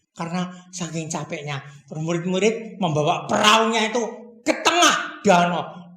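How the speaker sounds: ordinary delivery, nothing marked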